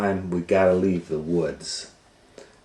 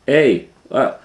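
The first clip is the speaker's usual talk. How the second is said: put-on loud startled cry of a boy grabbed; sudden loud yell; 7 semitones higher, 12 dB louder than usual. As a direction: yelp out in alarm. Hansel grabbed by the witch.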